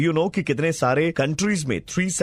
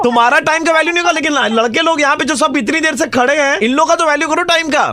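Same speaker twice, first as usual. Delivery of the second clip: loud, much higher scolding yell; angry shout; 13 semitones higher, 9 dB louder than usual. Acted. Prank caller shouting at the woman for keeping everyone waiting. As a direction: bellow at her for not turning up, pitched high.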